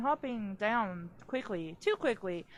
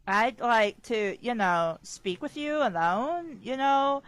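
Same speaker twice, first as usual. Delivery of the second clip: slow, insistent, louder request; pressing; 3 dB louder than usual. Acted. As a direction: lean on every phrase to press him. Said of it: "I pressed".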